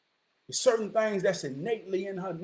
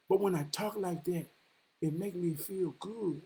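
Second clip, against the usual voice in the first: soft, lowered voice for her admission; quiet confession; 4 semitones lower, 9 dB quieter than usual. Retold or imitated. Woman admitting to him why she talks mean, quietly. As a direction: admit it softly and low.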